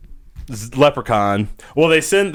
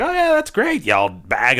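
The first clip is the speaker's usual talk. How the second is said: sarcastic mutter; 5 semitones higher than usual. Retold or imitated. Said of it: "he'll be like".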